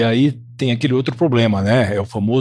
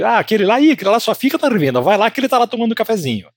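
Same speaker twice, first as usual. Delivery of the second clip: higher-pitched, quick tattling voice; mocking gossip; 9 semitones higher, faster than usual. Gossip about the salesman who only hangs around the store, mocking. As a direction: imitate someone pointing out a loafer.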